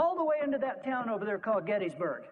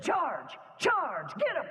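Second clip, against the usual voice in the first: battle cry; 6 semitones higher, much slower than usual. Retold or imitated. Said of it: "swung his sword down the hill and said".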